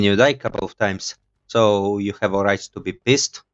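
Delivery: ordinary talk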